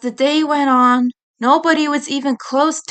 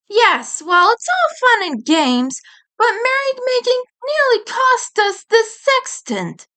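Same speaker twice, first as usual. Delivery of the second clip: high, fussy know-it-all voice; prim scolding boy; 12 semitones higher than usual. Acted. Edd chiding friends for roughhousing, prim and high. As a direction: scold them like a prissy schoolboy.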